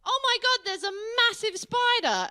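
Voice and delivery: high squealing panic over a spider; wife panicking. Retold or imitated. English wife shrieking at a massive spider, mock panic. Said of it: "I'll suddenly hear her go".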